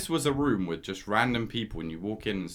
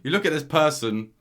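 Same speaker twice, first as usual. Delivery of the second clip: ordinary talk, nothing marked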